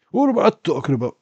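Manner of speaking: mock voice. Streamer mocking his own mistake in a silly squeaky voice. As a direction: squeak it out fast like a cartoon.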